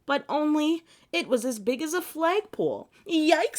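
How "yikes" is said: high, loud startled yelp; little girl's startled cry; startled yikes. Acted. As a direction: jump back in fright. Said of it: "said Julie".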